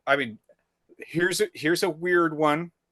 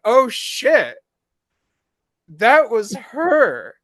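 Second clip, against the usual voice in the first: retold shocked realization; 9 semitones higher, 7 dB louder, much slower than usual. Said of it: "I was like".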